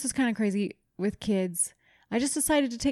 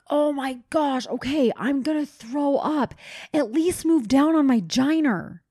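raised, higher voice with headphones in; loud, exasperated mid-crunch complaint; retold loud groaning; 5 semitones higher than usual. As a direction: talk too loud over your headphones. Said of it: "talking so loud".

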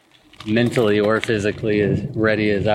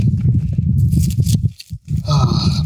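weary sigh; 4 semitones higher than usual. Real, not acted. Worried about mileage, tired.

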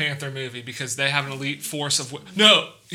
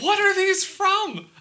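baffled; slower than usual. Real, not acted. Baffled by the quote.

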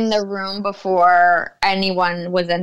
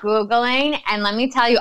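normal talking voice

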